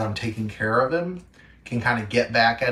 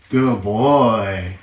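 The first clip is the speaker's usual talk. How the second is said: loud excited praise; 8 dB louder, much slower than usual. Real, not acted. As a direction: cheer the pup on. Praising puppy loudly.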